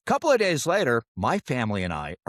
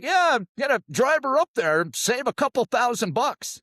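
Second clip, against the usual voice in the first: high, hammed-up old Dad; old man voice; 13 semitones higher, 4 dB louder than usual. Son quotes dad's plan to his sister, old man voice. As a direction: play the scheming old father.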